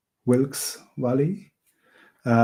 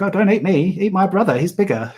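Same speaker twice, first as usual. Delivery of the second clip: high, louder little-goat voice, begging; pleading little goat; 8 semitones higher, 6 dB louder than usual. Retold or imitated. Little goat begging the troll not to eat him, high and loud. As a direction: squeak it out to save your skin. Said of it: "the smallest of the three billy goats".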